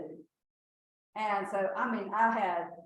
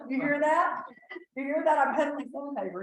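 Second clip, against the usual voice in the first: mock bragging; 6 semitones higher, 8 dB louder than usual. Retold teasing her husband, louder.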